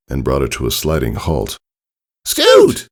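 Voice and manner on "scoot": high, loud call across the meadow; yelled name. Acted. Friends calling out to fallen Scootaloo, loud high yell. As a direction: shout her name in alarm, much higher. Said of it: "Applebloom and Sweetie Belle yelled".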